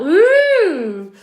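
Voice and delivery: high, loud delighted ooh. Real, not acted. Streamer seeing the sun come out, a high delighted ooh. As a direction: coo with real delight at the sunshine.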